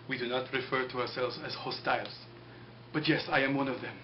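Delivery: calm prisoner admitting it softly; lightly voiced quiet Saeed.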